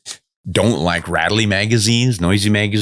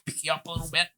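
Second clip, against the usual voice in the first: soft talk to the dog; 10 semitones higher, 12 dB quieter, much faster than usual. Real, not acted. Lifts the dog up.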